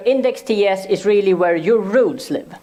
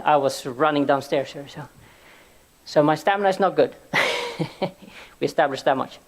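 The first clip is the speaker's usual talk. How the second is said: normal talking voice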